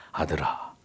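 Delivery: soft, low, affectionate call; tender. Shown as a demonstration. Father calling his son the right way, tender. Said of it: "다정한 표현입니다".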